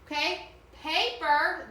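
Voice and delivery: ordinary talk, nothing marked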